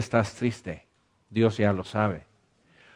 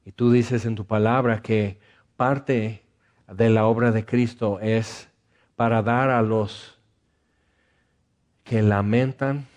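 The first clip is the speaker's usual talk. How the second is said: earnest pastoral prayer. Acted. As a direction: pray gently over the sad. Leads prayer for the sad.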